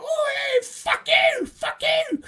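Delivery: very high squawking English parrot voice; English-accented parrot. Retold or imitated. Foul-mouthed zoo parrot heckling passing patrons in English accent, high squawk. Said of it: "in an English accent".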